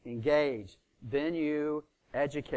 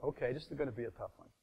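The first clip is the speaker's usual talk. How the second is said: muttered aside; 2 semitones lower, 10 dB quieter, much faster than usual. Retold or imitated.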